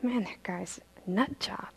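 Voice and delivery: low, very soft grumble; muttered aside. Acted. Strong men sent to roll the stone, muttering aside. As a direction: mutter it under your breath.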